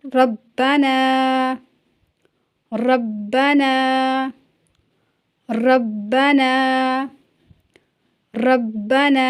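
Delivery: ربنا very slow, with a heavy ra; Urdu: ربنا آہستہ اور پُر. Shown as a demonstration. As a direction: drag out ربنا, thickening the opening ra. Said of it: "اس طرح سے".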